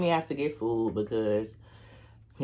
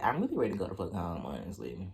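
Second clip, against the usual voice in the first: fed up; 6 semitones lower, 7 dB quieter than usual. Retold or imitated. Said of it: "I was so blue".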